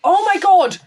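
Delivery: loud high exclamation; real eager outcry; excited outburst. Real, not acted. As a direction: burst out with excitement. Sudden excited topic change.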